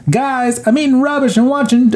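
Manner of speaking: high-pitched mock kid voice; Kevin. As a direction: call out as a cheeky child. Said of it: "And Kevin, who's watching the movie, says".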